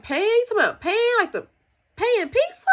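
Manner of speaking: incredulous mock-mom questioning, pitch jumping up; puzzled high-pitched query, rising on pizza; baffled mom. Mother puzzled by her daughter's word pan, baffled.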